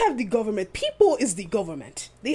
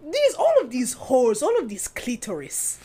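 West African accent; 3 semitones higher than usual. Shown as a demonstration. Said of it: "West African is, like, more definitive".

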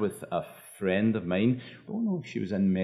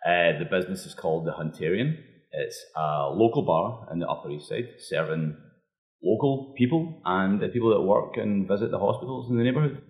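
thick Scottish accent, relaxed talk; broad scottish accent. Real, not acted. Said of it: "thick Scottish accent".

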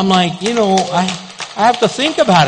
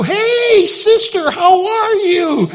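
high, slow, sing-song hello; cheery greeting; 12 semitones higher, much slower than usual. Retold or imitated. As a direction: greet her sweetly, drawn out. Pastor greets startled churchgoer.

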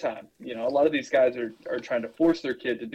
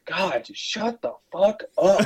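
mom snapping; 7 semitones higher, 4 dB louder, slower than usual. Retold or imitated. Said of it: "dude just yelled at this kid".

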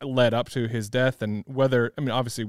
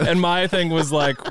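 ordinary talk

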